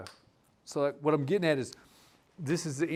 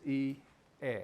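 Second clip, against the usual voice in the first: said slow. Shown as a demonstration. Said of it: "But if you say it slow".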